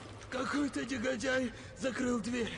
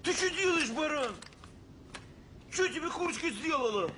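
angry yell; 10 semitones higher than usual. Acted. Cop yells at partner over chicken.

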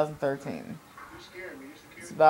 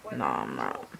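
much lower, softer sly confession; sly whisper; 6 dB quieter than usual. Pretend. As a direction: drop low and quiet, sly. Cheeky retraction.

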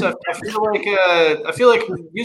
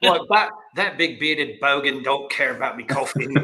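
lower-pitched, badly faked Australian accent; not-quite-Australian accent; 4 semitones lower, 3 dB quieter than usual. Joking as an Australian about coffee, badly faked Australian accent. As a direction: put on a shaky Australian accent that drifts toward Boston. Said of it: "that also wasn't quite Australian".

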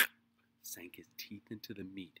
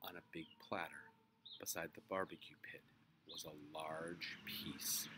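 ordinary delivery

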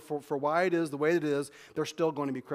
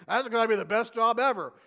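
mock sarcastic critic; 5 semitones higher, 5 dB louder than usual. Quoting critics of preachers.

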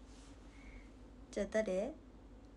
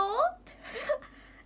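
high squealing laugh; 14 semitones higher, 5 dB louder than usual. Real, not acted. Laughing at word.

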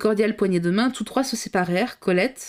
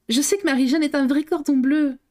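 gaily; 7 semitones higher, 3 dB louder than usual. A narrator's reading. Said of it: "soulignait gaiement Colette".

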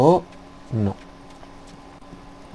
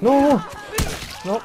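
shocked cry; 12 semitones higher than usual. Real, not acted. Player gets hit suddenly.

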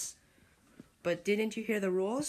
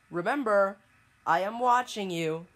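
louder, looming reminder that you're being watched; menacing warning; 7 dB louder than usual. Acted. Fake virus threatening the user, louder and menacing. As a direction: lean in like a creepy watcher.

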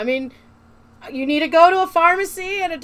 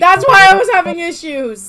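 louder, exasperated explanation; 3 semitones higher, 10 dB louder than usual. Real, not acted. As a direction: push it out, fed up.

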